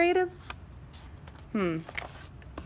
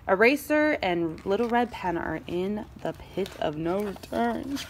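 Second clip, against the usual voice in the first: ordinary delivery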